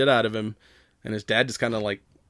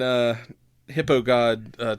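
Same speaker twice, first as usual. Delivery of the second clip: normal talking voice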